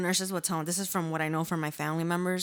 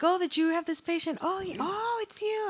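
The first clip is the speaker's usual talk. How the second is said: much higher, sing-song imitation of a surprised nurse; mock nurse voice; 12 semitones higher than usual. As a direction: squeal it like the nurse recognizing her patient. Nurse voiced in retold story.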